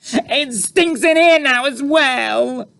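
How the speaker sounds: put-on very high, slow, loud girl's scream. A narrator's reading. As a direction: shriek in disgust. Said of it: "she screamed".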